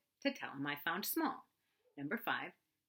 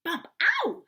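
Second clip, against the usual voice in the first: tiny high squeal of pain; a small animal squeaking ow; 12 dB louder than usual. Acted. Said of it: "squeaked something".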